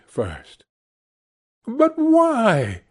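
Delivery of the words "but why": high pleading cry; cried out. Acted. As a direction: burst out in protest. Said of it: "cried Scrooge's nephew".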